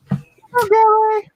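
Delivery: very high, silly falsetto hello; playful falsetto greeting. Pretend. Host welcoming Gary onto the show, playful falsetto.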